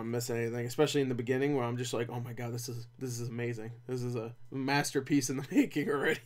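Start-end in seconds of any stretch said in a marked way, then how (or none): 2.1-6.2 soft, low, understated amazement; hushed mock awe. Reviewer recalling note-taking in the cinema, hushed awe. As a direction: say it softly, as if to yourself.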